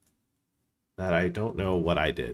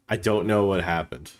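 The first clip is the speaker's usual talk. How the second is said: ordinary delivery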